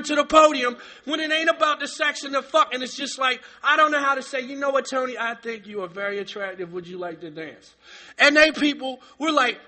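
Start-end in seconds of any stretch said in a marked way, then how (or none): 4.4-7.7 soft, lower, overly proper mock invitation; mock polite. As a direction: play the prim gentleman asking to dance. Mocking proper dating talk.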